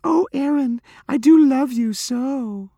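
loud, very high, drawn-out falsetto; mocking falsetto. A narrator's reading. Giggling group taunting Abra behind her back, mocking falsetto. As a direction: mimic a lovesick girl in squealing falsetto. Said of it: "a falsetto followed her".

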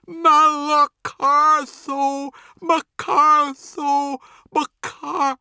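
high, drawn-out wailing shrieks; crying out. A narrator's reading. Possessed Cat cries and vomits, shrieking high. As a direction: shriek it repeatedly, cut off at the end. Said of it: "she cries and vomits".

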